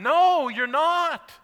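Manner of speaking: very slow, drawn-out no you're not; insistent reply. Retold or imitated. Child insisting to a deaf mother, slow and emphatic. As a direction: stretch every word to make her hear.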